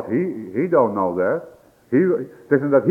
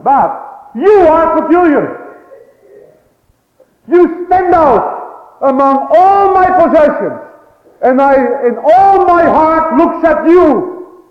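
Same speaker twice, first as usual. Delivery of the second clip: loud, rising, slower sermon climax; fervent preacher's cry; preaching at full force; 14 semitones higher, 12 dB louder, slower than usual. Acted. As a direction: drive it home from the pulpit. Voicing the Lord at full force.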